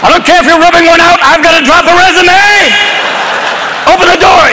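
bang on the door yelling; frantic yelling. Pretend. Yelling at son through door.

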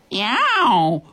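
sharp startled yowl; animal yowl. Acted. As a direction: yelp it like a spooked animal. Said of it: "the noise it went".

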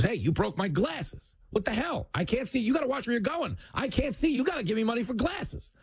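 high-pitched put-on con man complaint; squealing mock outrage; aggrieved street hustler. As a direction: play the outraged victim in a high voice. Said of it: "drops his broken glasses on the ground and goes".

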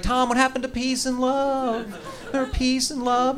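high, slow mock wife voice; sing-song chiding in a high put-on voice; nagging wife. Wife chiding him while he drives, high slow mock voice. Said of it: "She's like".

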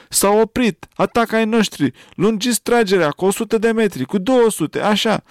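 high, loud excited yelling; overjoyed child crying out orders; joyful shout. A narrator's reading. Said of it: "striga în receptor". (strigăt de bucurie)

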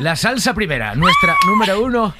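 high, shouted game-show reveal; excited announcing. Real, not acted. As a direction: proclaim the lucky result at a high pitch. Host announces lucky result. (anuncio eufórico a gritos)

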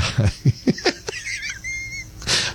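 falsetto giggle; a shrill gleeful laugh at the caller; high cackling giggle; high squeaky laugh. Real, not acted.

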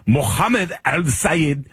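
mock-careful Arabic accent on the name; exaggerated Arabic pronunciation. Shown as a demonstration. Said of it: "let me just get his name right".

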